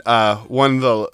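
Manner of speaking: usual speaking voice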